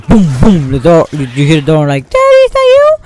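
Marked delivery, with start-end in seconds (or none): 2.1-3.0 very high, loud frightened call; scared child. Pretend. Child hearing the door bang, calling high and scared for daddy. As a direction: call out hoping it's dad.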